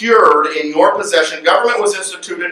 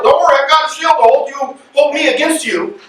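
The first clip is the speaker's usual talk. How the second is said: mocking voice; 9 semitones higher than usual. Mocking the government's promise.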